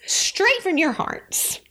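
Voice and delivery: ordinary delivery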